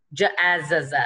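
"j, a, z, z" broken up, z voiced twice; wrong double sound. Shown as a demonstration. Teacher shows what it's not, doubled z sounded out. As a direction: sound out "j, a, z, z", repeating the z. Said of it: "it's not j".

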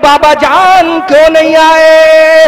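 impassioned majlis lament; preaching at full force. Acted. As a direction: cry it out to the gathering. Preacher voices Zainab's lament.

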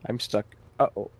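ordinary talk, nothing marked

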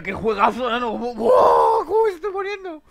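high excited yell; excited shout. Real, not acted. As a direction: shout it out, thrilled. Excited reaction to game.

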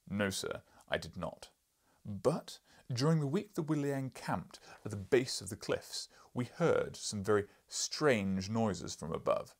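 loud, booming lecturing professor; gruff professor. Acted. Professor lecturing the journalist, loud and booming. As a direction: boom like an irritable scholar.

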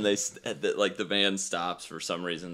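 usual speaking voice

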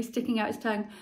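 usual speaking voice

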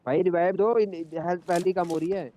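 high loud panicked plea for revive; panicking gamer. Real, not acted. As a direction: beg the team to save you. Knocked down, begging for revive.

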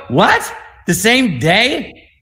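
high, stretched-out incredulous question; replayed amazement, pitch leaping up; astonished disbelief. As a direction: act out stunned delight at the news. Reacting to surprise double booking.

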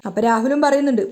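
plain reading voice

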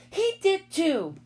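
high, drawn-out contradiction; slow, high comeback; insistent girl arguing. A narrator's reading. Sheila argues back.

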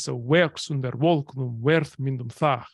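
"werk under wolknum, werthmündum thach" mit Betonung auf den Hebungen; beats on the stresses. Shown as a demonstration. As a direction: pulse each lift clearly. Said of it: "pulse is on the stresses".

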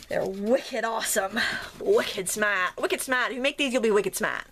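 a hint of a Maine accent with dropped r's; mild Maine "wicked smart" accent. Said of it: "for that accent".

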